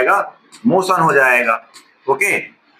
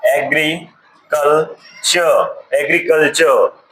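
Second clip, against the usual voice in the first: Agriculture stretched slowly syllable by syllable; teacher slowly sounds out agriculture; drawn-out spelling word; much slower than usual. Shown as a demonstration. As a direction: draw out agriculture slowly for the class. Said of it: "जैसे हमारा क्या हो गया ये".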